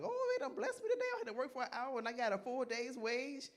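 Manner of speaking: high-pitched mock delight, easing down; overjoyed worker. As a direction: act out a thrilled, lucky worker. Acting an overjoyed one-hour worker.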